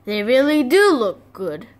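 ordinary delivery

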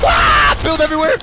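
high panicked yell; panicked shout. Real, not acted. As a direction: yell in panic under fire. Panicked under fire.

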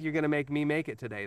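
usual speaking voice

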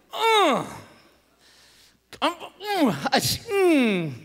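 strained growling "mm"; sputtering groans of pent-up anger; frustrated grunts. Acted. Preacher acting too mad to speak, frustrated. Acts speechless rage.

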